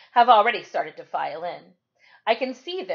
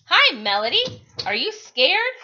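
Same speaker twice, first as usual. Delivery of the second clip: put-on nervous girl; 8 semitones higher, 7 dB louder than usual. A narrator's reading. Nervous Rose greets Melody.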